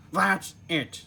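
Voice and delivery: a hammed-up Squidward losing patience; fed-up outburst. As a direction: throw up your hands in annoyance. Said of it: "said Squidward".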